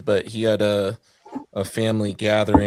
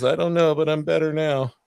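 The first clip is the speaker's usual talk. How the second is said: laughing; 6 semitones higher than usual. Retold or imitated. Friend's answer retold with laughter.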